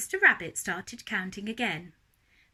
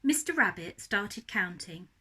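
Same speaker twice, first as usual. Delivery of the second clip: ordinary delivery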